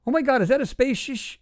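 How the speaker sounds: high astonished question, cut short; imitated shock. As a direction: mimic her amazement, last word broken off. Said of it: "you can literally hear her say".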